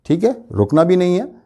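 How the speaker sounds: ordinary delivery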